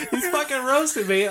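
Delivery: can barely get the words out for laughing. Real, not acted. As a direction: talk through real laughter. Laughing at the memory.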